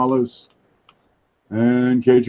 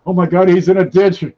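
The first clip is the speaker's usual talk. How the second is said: mock panic; 9 semitones higher, 4 dB louder than usual. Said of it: "That probably made you think".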